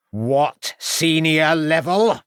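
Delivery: slow indignant landlady demand. A narrator's reading. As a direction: challenge him, word by word.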